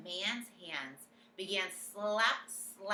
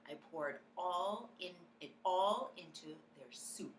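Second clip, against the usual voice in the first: whispering. A narrator's reading. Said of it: "I whispered".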